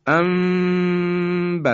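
Quran recitation. Shown as a demonstration. Teacher reciting the word slowly to model iqlab. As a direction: hold the nasal mim in انبتت.

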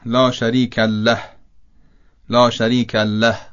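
له said with a doubled, stressed lam; wrong tashdid on له. Shown as a demonstration. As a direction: lean hard on the double l in له.

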